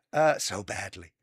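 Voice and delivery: retry the boast in a bad voice; Trump impression. Trying the impression again, mock boast. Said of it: "it's getting better".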